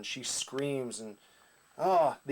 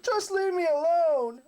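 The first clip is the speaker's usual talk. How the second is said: mock whiny wail, much higher and louder, slow; whiny teen; 5 dB louder than usual. Mocking a serious guy who whines like a sulky teen, high wailing cry. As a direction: send up a hero sulking like a teenager. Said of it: "a guy you're supposed to take seriously is like".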